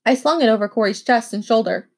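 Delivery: ordinary delivery, nothing marked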